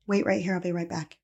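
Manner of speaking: soft, trailing instruction; retold hushed aside. Man leaving her to answer door, hushed retold voice. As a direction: drop your voice as he slips away.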